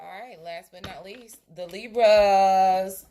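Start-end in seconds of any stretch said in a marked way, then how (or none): 1.6-3.0 loud, high, long-drawn announcement; big drawn-out introduction; announcing. Real, not acted. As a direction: announce it loud, stretching the name right out. Announcing the next sign.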